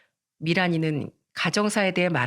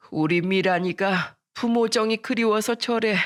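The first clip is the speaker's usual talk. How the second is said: put-on old woman's sighing pity; lamenting grandmother; 3 semitones higher than usual. Grandmother explaining Miran's loneliness to friend, pitying.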